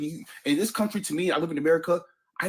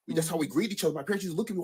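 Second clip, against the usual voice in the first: normal talking voice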